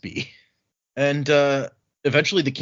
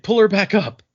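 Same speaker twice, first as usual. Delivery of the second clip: louder, pitched-up command; barked order; 9 semitones higher, 6 dB louder than usual. Retold or imitated. The guard ordering the crew to haul her up, a loud barked command.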